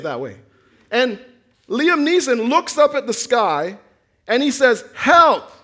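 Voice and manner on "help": a loud cry for help, higher and louder; retold scream for help. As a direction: call out to the sky for help. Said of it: "He's screaming for help".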